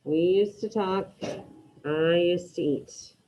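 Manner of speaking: We used to talk and I used to eat read slowly, each word separate; teacher dictating phrases to the class; slow dictation. Shown as a demonstration. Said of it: "with these first two".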